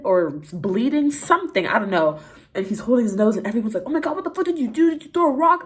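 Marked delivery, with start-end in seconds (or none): 3.8-5.7 retold yelling. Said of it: "yelling at him".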